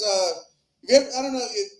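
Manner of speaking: ordinary talk, nothing marked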